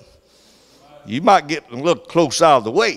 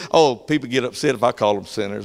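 ordinary delivery

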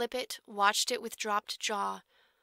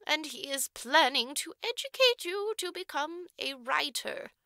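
higher, louder, formal matron voice; stern orphanage matron; 6 semitones higher, 5 dB louder than usual. A narrator's reading. Matron informing the orphan girl of her benefactor's terms, prim and formal. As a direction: lay out the terms with prim authority.

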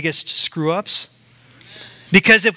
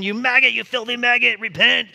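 mock street preacher yell; 6 semitones higher than usual. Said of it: "they're yelling and screaming".

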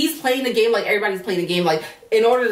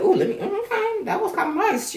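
much higher, cooing mock-satisfied guy; mocking Sammy; 11 semitones higher than usual. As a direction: ham up a smug pleased sigh. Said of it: "And that's why he's like".

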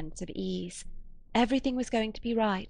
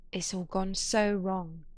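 usual speaking voice

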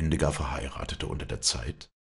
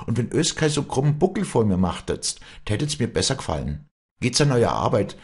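higher, firm dialect voice; Walli, brisk mistress; 6 semitones higher than usual. A narrator's reading. Walli ordering the servants to work, brisk and firm. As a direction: set the servants straight, pitched up.

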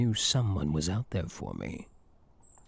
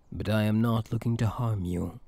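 usual speaking voice

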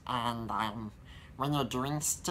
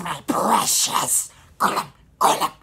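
Gollum; 5 semitones higher, 15 dB louder than usual. Shown as a demonstration. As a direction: hiss out my precious like the creature. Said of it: "as I'll show now".

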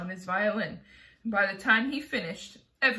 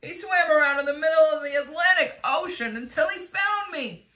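loud, high, amazed outburst; gasped exclamation; 6 semitones higher, 7 dB louder than usual. A narrator's reading. Rescued centipede praises James's swim, exclaiming. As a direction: shout it in thrilled relief. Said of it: "gasped the centipede".

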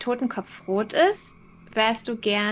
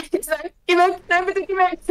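mock pleading; 6 semitones higher than usual. Pleading with monster.

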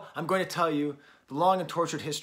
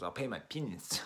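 put-on New Zealand accent; 3 semitones lower, 7 dB quieter than usual. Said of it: "her best usage of a New Zealand accent".

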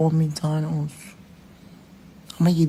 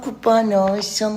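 ordinary talk, nothing marked

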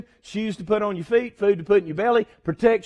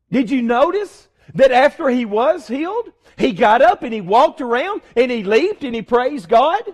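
louder, higher, building preacher fervor; rising preaching; 5 semitones higher, 5 dB louder than usual. Real, not acted. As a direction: lift the congregation with rising excitement.

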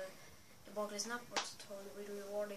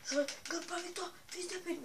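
mock threat; 8 semitones higher, 4 dB louder than usual. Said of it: "Non, je déconne".

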